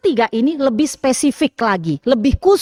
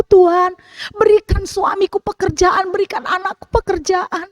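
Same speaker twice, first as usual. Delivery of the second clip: acted lament; 7 semitones higher than usual. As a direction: cry out to God like a grieving widow. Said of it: "Kita berdoa sambil meratap, sambil menangis".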